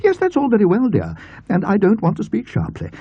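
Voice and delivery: an aunt trying not to speak sharply but failing; exasperated aunt. A narrator's reading.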